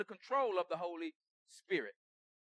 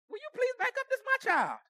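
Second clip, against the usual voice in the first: much higher, sharp "back up"; irritated snap. Retold or imitated. Parent snapping at someone who took the child, irritated. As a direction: shoo them away.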